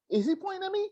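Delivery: high, self-centred listener imitation; high-pitched mock worry; mock alarmed. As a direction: act out someone suddenly feeling singled out. Mimicking a paranoid listener.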